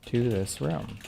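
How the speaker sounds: soft, spaced-out words, pausing between phrases; careful repeat. Real, not acted. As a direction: repeat it slowly and quietly for someone who missed it. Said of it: "that again?".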